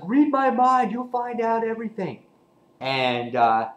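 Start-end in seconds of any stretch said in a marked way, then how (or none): 0.0-2.2 high put-on voice, much higher and louder; mock mysterious voice. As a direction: play it like a spooky mind reader teasing. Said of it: "he's just like".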